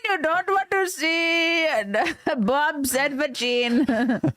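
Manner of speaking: pleading mock Indian voice, slowing at the end; hammed-up Indian creep; put-on Indian accent, drawing out the last words. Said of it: "the Indian guy".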